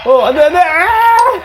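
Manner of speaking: high yelps of no ending in a cry; panicked cry. Real, not acted. Gamer watching his runner fall, high panicked yelp. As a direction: cry out as it slips away. Character falls again.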